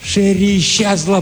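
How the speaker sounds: high, strained cry; shouting. A narrator's reading. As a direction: call it out desperately. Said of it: "прокричал он".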